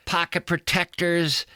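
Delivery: normal talking voice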